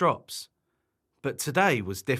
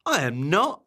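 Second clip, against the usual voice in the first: mildly huffy, louder retort; grumpy storybook character; grumpy denial; 3 semitones higher, 4 dB louder than usual. Acted. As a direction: deny it in a bad mood. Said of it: "was in a very bad mood".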